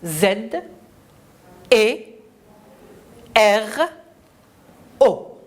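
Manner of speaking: one letter at a time, long pauses; Z, E, R, O each said separately; spelling aloud. Shown as a demonstration. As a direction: lead children through the letters. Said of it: "Épelons ensemble".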